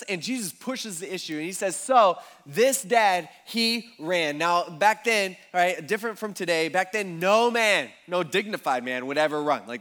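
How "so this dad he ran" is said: slow and louder, every word weighted; emphatic slow reveal. Retold or imitated. Jesus pushes the issue, slow and emphatic. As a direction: land it slowly so it sinks in. Jesus presses his point.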